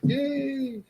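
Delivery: high, drawn-out cheer; mock parental cheer. Parents greeting Denise's arrival, high mock cheer. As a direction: imitate overjoyed parents cheering. Voicing his delighted parents.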